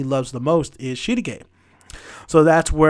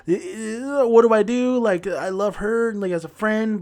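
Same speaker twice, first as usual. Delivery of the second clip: higher-pitched imitation of a torn girl; mock fretting; 5 semitones higher than usual. Girl torn between friend and crush, mock fretting. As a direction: act out lightly flustered indecision.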